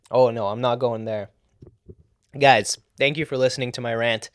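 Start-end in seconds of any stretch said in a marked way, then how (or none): none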